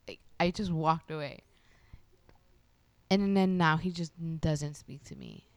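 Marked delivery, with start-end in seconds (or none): none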